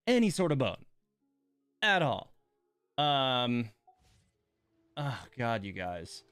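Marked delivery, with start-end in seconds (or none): none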